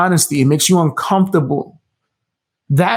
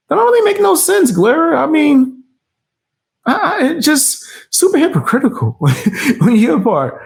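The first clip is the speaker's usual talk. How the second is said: mock whine; 10 semitones higher than usual. Mock-whining rival's complaint.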